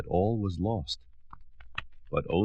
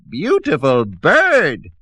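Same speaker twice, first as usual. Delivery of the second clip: high, loud, slow squawk; squawking witch; 9 semitones higher, 9 dB louder, slower than usual. Acted. Old Witch praising her crow, loud slow squawk. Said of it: "she squawked".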